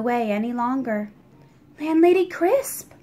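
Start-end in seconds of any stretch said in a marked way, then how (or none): 1.8-2.9 put-on higher boy's voice calling her name; boy calling to the tearful landlady; calling out gently. A narrator's reading. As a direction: reach out to her with concern. Boy calls to upset landlady.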